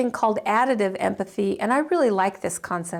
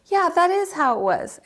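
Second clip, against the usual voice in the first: higher, lightly voiced client agreeing; a hint of a relieved client, pitched up; 7 semitones higher than usual. Client finally feeling understood, pitched up relief. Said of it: "they'll go".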